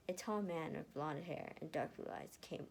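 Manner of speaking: plain reading voice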